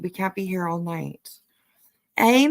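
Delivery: usual speaking voice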